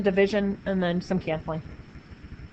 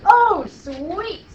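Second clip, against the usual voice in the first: excited; 10 semitones higher, 6 dB louder than usual. Retold or imitated. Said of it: "I was like".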